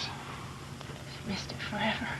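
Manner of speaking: whisper. Acted. Grieving sister whispering.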